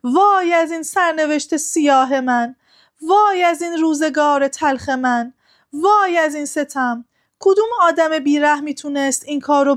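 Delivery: a lightly acted weeping lament; crying lament. A narrator's reading. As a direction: cry out in mourning for her son. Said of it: "اشک ریخت و فریاد زد".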